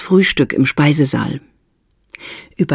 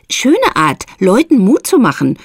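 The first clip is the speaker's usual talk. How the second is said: scornful; 7 semitones higher than usual. A narrator's reading. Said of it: "höhnte Esther".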